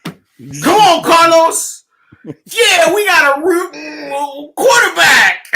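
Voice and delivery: high, loud joyful yell; a player yelling to Carlos about the new quarterback; excited shout. Pretend. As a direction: shout it like the dream just came true. Acting Eddie Jackson's joy.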